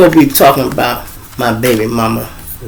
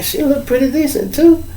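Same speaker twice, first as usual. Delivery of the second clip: high impressed; 13 semitones higher, 4 dB quieter than usual. Retold or imitated. Sizing up the girl.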